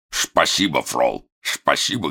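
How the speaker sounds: put-on slow, lisping old man mumble; toothless mumble. A narrator's reading. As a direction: mumble it as if without teeth. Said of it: "прошамкал".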